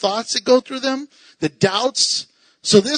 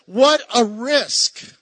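usual speaking voice

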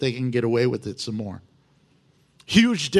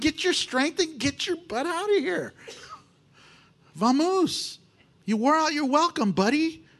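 high-pitched comic shooing voice; impatient witch; 10 semitones higher than usual. Acted. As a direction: send an unwanted guest packing, hammed up. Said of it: "She's a witch".

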